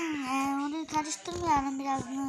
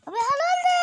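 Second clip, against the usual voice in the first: excited shout; 10 dB louder than usual. Real, not acted. Excited exclamation in game.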